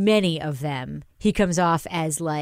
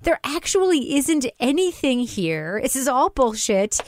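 exasperated urging; 8 semitones higher than usual. Retold or imitated. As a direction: push it up, urging the lawyer not to fold. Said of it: "And I'm just like".

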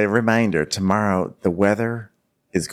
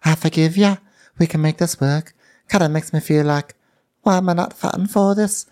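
high, far-off put-on voice; imitating Tasha talking to camera; mock Tasha; 11 semitones higher than usual. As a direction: gush it like a silly reality star. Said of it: "then she tells us".

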